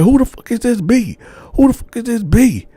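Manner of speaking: imitating a furious girlfriend; angry girlfriend. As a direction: confront him over the photos. Girlfriend confronts him, acted.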